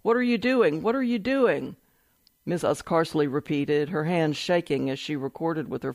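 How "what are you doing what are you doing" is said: higher-pitched quote of an alarmed plea; mildly distressed protest. A narrator's reading. Wife protesting as agents handcuff her husband, mildly distressed. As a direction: read it as an understated, higher cry of disbelief.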